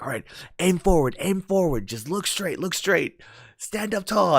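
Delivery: high, urgent calls of encouragement; mock coaching shouts. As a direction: call out pointers to friends, high and loud. Acting out the guys cheering themselves on.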